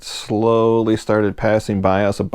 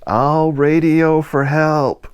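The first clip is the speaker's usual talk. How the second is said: high, slow called-out answer; yelled back; 8 semitones higher, 3 dB louder, much slower than usual. Retold or imitated. Deck worker calls down to the stranded boat, slow high shout. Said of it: "the guy on the deck yelled back".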